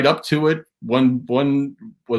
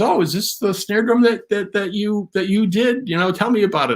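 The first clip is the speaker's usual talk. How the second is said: lightly voiced woman; 7 semitones higher than usual. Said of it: "she goes".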